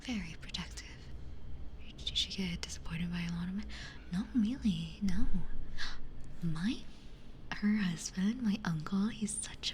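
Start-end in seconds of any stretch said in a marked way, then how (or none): none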